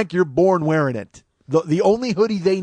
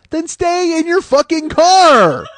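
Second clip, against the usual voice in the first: higher, louder and slow, yelling each word; furious shout; 8 semitones higher, 7 dB louder, much slower than usual. Real, not acted. Ranting at the shooter to stay in his car, furious shout. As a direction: hammer it home like a furious order. Shouting the cops' advice back.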